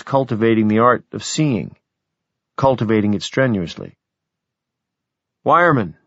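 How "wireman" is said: called out. Acted. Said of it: "I called".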